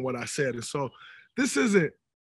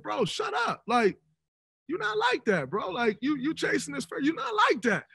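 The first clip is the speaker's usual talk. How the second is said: dismissive retort; 9 semitones higher than usual. Retold or imitated. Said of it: "I'm like".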